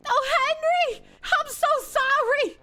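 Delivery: high, pleading falsetto apology; mock tearful woman. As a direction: beg forgiveness in a hammed-up girlish voice.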